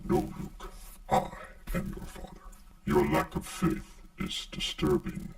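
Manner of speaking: deep, processed dark-lord voice; Darth Vader. Shown as a demonstration. Maker quoting the villain to Luke, deep processed voice. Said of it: "the Darth Vader sound".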